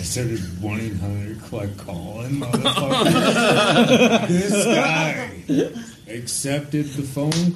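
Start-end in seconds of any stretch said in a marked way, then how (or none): 2.4-5.3 slow, high laughing exclamation. Real, not acted. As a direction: burst out laughing on the word. Laughing punchline of a story.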